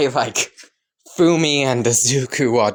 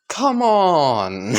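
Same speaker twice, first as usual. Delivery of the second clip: higher, deflated whine; dejected plea; 4 semitones higher than usual. Acted. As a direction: whine it as if slumping down. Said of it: "All Might would just kind of slump down".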